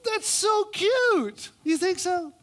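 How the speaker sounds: very high, slow "so cute"; hammed-up squealing friend; mock-girly gushing; gushing woman. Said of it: "Woman number one".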